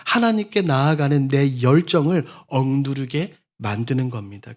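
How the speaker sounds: usual speaking voice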